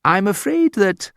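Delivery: high, prim I'm afraid that; polite rudeness. Shown as a demonstration. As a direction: say it with icy courtesy. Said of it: "Terribly rude things to say".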